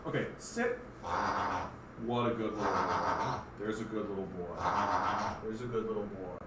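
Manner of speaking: soft, low doting praise; soft pet praise. Real, not acted. Owner praises the pug for sitting, soft low doting. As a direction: dote on him quietly.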